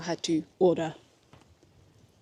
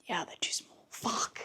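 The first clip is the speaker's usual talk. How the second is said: whispering; 11 dB quieter than usual. Real, not acted. Disappointed whisper trying on shoes.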